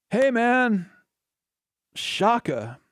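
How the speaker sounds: high-pitched surfer-dude greeting; Yamcha. Acted. Yamcha selling Jell-O shots at concerts, surfer-dude impression. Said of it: "Yamcha just seems like the type of guy".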